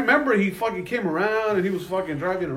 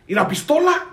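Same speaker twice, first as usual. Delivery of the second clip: sharp, higher cop question, rising at the end; cop's curt demand; 9 semitones higher than usual. Retold or imitated. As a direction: snap it out like the officer did. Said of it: "Just like that".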